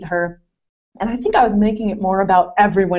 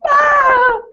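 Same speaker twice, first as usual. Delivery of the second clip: sharp, very high, loud wordless cry of frustration; frustrated yell; 5 dB louder than usual. Retold or imitated. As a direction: let out a hammed-up squeal of exasperation. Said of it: "I was like".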